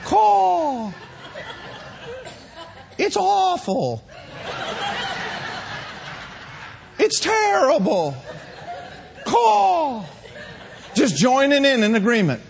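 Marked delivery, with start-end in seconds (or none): 0.0-10.0 high cawing crow, half laughing; high mock crow croaking woe. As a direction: caw like a gloomy crow. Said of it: "the other bird steps up and says".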